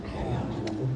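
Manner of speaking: unvoiced timid whisper; a hushed, faithless mutter; doubtful whisper. Shown as a demonstration. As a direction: whisper it as if unsure. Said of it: "Kasi baka mamaya hindi nangyari".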